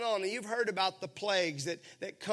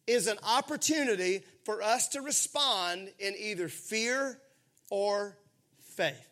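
ordinary delivery

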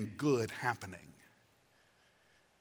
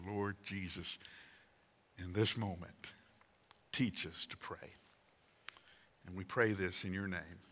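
prayer; 4 semitones lower than usual. Real, not acted. Pastor leading congregation in prayer to the Lord, hushed prayer.

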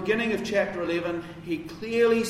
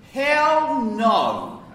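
loud, higher "hell no", hell hit hard; emphatic; 7 semitones higher, 8 dB louder than usual. Shown as a demonstration. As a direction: blurt "hell no" with full force. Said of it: "It's emphatic".

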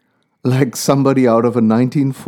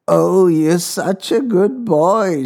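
put-on high, very slow old lady voice; sweet old woman; 6 semitones higher, much slower than usual. A narrator's reading. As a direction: coo it like a grateful grandmother. Old woman thanks driver.

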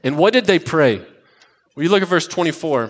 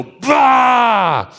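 monster scare shout; 5 semitones higher than usual. Pretend. Dad jumping out from behind the bed, playful monster shout. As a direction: leap out and roar to scare the kids.

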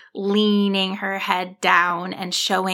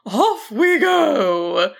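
higher, louder, slow cheer of a happy dragon; 6 semitones higher, 4 dB louder, much slower than usual. Acted. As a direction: cheer it out as she takes off. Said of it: "the dragon cheered".

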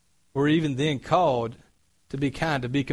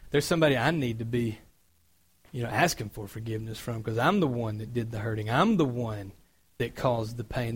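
remorseful; 5 semitones lower, 5 dB quieter than usual. Retold or imitated. Imagined remorseful inner voice.

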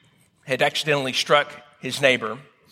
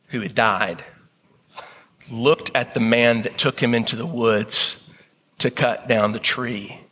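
ordinary talk, nothing marked

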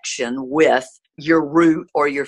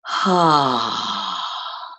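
deep exhale; 4 semitones lower than usual. Shown as a demonstration. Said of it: "as you breathe".

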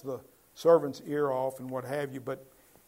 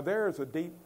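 normal talking voice

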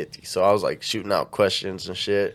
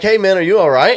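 high, louder retold phone check-in; raised-pitch acted question; mock concerned friend; 8 semitones higher, 7 dB louder than usual. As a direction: lightly imitate a friend urgently checking in. Acted worried call from friend.

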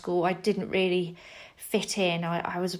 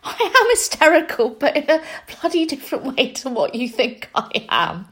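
much higher, louder mock declaration; defiant retort; 6 semitones higher, 5 dB louder than usual. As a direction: insist on it theatrically. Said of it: "And I'd be like".

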